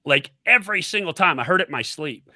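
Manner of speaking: ordinary delivery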